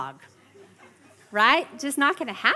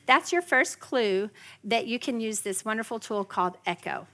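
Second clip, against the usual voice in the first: usual speaking voice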